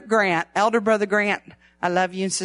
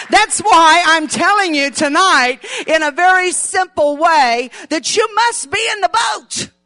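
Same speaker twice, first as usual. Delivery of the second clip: rising, louder preacher's shout; higher and louder fiery preaching; preaching at full force; 5 semitones higher, 5 dB louder than usual. Real, not acted. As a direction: build the sermon to a cry. Preaching builds to climax.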